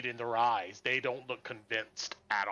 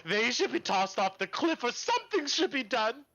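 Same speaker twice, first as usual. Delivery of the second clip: bitter angry woman; 10 semitones higher than usual. Acted. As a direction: spit her distrust at the paladins. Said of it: "One of the ladies ... goes".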